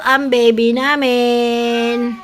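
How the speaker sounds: high, loud, slow and drawn-out; loud announcement. Real, not acted. Vlogger announcing the nursing baby, loud drawn-out call. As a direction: proudly call it out, stretching the words.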